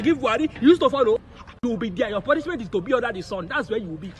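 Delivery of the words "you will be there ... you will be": softer, lower firm sentence; a hint of stern father; stern low verdict. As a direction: hand down punishment quietly. Stern punishment.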